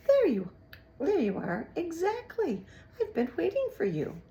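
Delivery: high, loud false cheer; welcoming rat. A narrator's reading. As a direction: greet him like a long-lost friend. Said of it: "called out to him as if he were a long-lost friend".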